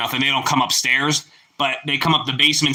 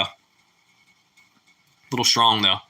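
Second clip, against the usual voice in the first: slow drawn repeat; 4 semitones lower, much slower than usual. Real, not acted.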